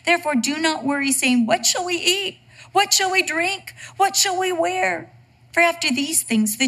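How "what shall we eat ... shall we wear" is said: worried voice. Retold or imitated. Mimicking worried people's questions.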